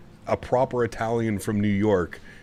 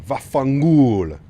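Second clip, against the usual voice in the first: hammed-up Italian accent, slow and loud; a playful Italian swear at Tony; mock Italian curse; 3 semitones higher, 7 dB louder, slower than usual. Teasing Tony with a slow, loud mock Italian curse.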